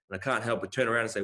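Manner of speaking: ordinary talk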